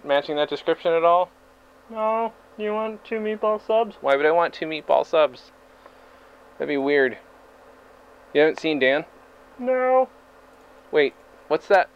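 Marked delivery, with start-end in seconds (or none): 1.9-4.0 high-pitched clerk answering; high worker voice. Acted. Voicing the Subway worker. 9.6-10.1 high-pitched clerk answering; high worker voice. Acted. Voicing the Subway worker.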